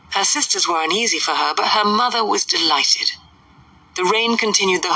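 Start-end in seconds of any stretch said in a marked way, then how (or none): none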